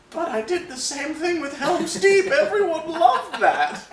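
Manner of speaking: high, slow hammy Peter Jackson voice; squeaky New Zealand impression; New Zealand accent. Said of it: "that was my New Zealand accent".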